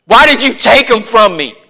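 loud, high accusing lament; bitter mourner. Retold or imitated. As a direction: hurl the blame at God. Mourner blaming God.

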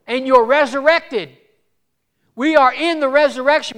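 loud, high, ringing proclamation; full-force preaching. Real, not acted. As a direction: drive each assurance home at the top of the voice.

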